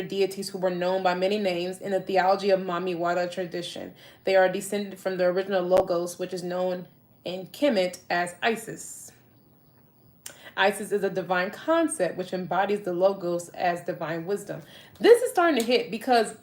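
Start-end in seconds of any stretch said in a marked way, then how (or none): none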